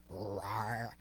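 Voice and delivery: a first try at roaring. Acted. Cub trying his first low roar.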